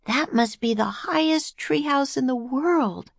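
put-on high, girlish wonder; amazed little girl. A narrator's reading. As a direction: marvel at it in a high child's voice. Girl marvels at treehouse.

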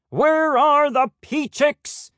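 high, slow, stuffy bird voice; a fussy head peacock; pompous peacock. Acted. Head peacock addresses the flock.

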